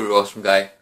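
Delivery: ordinary talk, nothing marked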